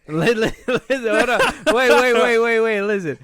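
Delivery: high and loud, jumping in to hold the floor; excited loud interruption. Real, not acted. Friend cutting in to push his wild idea, loud and excited. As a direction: wait wait, bursting in over the other.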